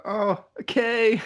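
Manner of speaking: high, slow drawn-out okay; put-on surprise. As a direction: fake innocent surprise. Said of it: "I'm just like".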